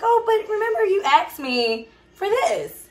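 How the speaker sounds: a hint of a patient God chiding; playful high God voice reminding you what you asked for; voicing God. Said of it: "And God's like".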